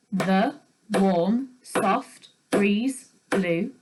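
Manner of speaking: very slow, each syllable pulsed for claps. Shown as a demonstration. Teacher clapping haiku syllables for the class, slow and even. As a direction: beat out every syllable slowly while clapping. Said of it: "with those syllables being clapped".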